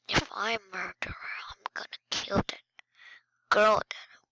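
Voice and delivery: fast, scheming whisper. Real, not acted. Gamer quietly plotting to take out a player, whispering. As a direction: hiss the plan quickly under your breath.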